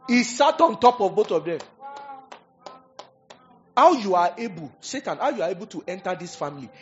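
loud, high, emphatic sermon; full-force preaching. Real, not acted.

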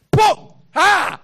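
high, loud burst; sudden high shout of impact; anointing shout. Retold or imitated. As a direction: exclaim as the power hits. Anointing strikes.

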